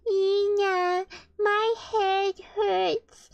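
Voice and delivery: put-on high, slow, drawn-out child's whine; whining child with headache. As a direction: plead like a poorly kid, stretching every word. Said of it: "Antonio's like".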